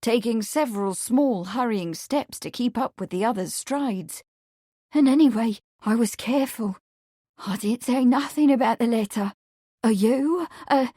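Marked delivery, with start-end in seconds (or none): 4.9-10.9 flustered, mildly upset defence; tearful, breathless. A narrator's reading. Meg defends herself to Marsh, tearfully breathless. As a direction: hurry out the excuse, a touch tearful. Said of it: "tearful breathlessness".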